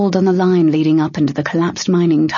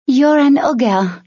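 high, slow, wary; a bold young thief sizing up an ogre; lively thief voice; 8 semitones higher, 3 dB louder, slower than usual. A narrator's reading. Tern addresses the ogre.

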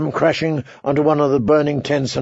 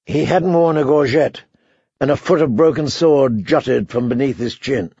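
usual speaking voice

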